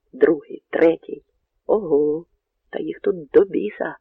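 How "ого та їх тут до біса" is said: ordinary delivery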